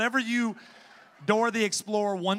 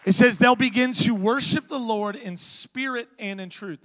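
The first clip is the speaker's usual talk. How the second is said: fiery preaching; 3 semitones higher, 9 dB louder than usual. Acted. Preacher urging lazy Christians in the congregation, fiery shout. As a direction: preach it out to the whole room.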